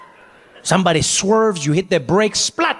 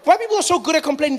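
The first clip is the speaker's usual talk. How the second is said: ordinary talk, nothing marked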